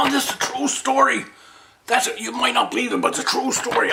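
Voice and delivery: high-pitched protest of truth; comic insistence. Acted. Storyteller swearing grasshopper tale is true, high insistence.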